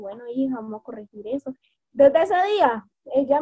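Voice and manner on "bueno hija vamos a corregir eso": soft, calm correcting tone; a mother quietly reassuring her daughter; softer, tender mom voice; gentle mother. Retold or imitated. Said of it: "ella se sienta conmigo".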